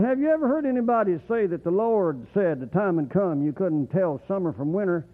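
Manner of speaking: ordinary delivery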